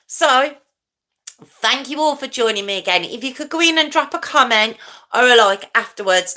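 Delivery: normal talking voice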